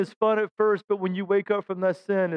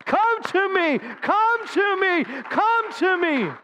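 higher, slow tender call; the Lord calling; 10 semitones higher, much slower than usual. Acted. Preacher voices the Lord calling sinners back, slow and tender. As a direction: draw them in, slow and longing. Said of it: "the Lord is calling you".

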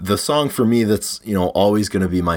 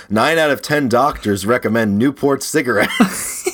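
slow, upbeat vintage ad announcer; chipper 1950s ad; 6 semitones higher than usual. Retold or imitated. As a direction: sell it like an old radio ad. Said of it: "you ever seen those old advertisements".

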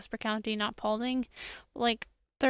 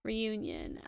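ordinary talk

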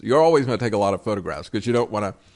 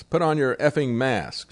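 ordinary talk, nothing marked

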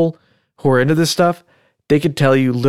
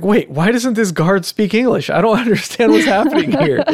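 higher, baffled and laughing; baffled disbelief; 9 semitones higher than usual. Retold or imitated. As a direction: act stunned at the absurd scene. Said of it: "I was like".

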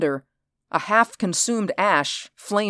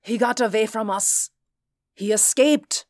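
alarmed; 6 semitones higher than usual. Acted. Officer reports the escape, alarmed.

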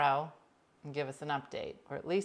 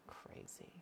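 soft whispered comment; whispered aside; 22 dB quieter than usual. Real, not acted. Reacting to the man-thing comment, whispered. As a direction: breathe the word out.

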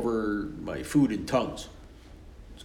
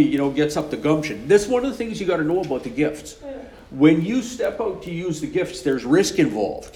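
ordinary talk

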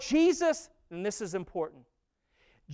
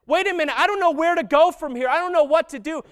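higher, louder, quick and bewildered; shocked Jesus; 5 semitones higher, 4 dB louder, faster than usual. Retold or imitated. Preacher voicing Jesus in shock, louder and higher. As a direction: voice a lost Jesus reaching for God.

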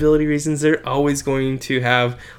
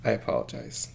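whispered apology; 4 semitones lower, 14 dB quieter than usual. Real, not acted. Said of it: "Let me apologize now".

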